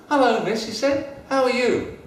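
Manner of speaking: put-on Ding Ding cheering up a lonely girl, high; friendly cap. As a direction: greet her brightly.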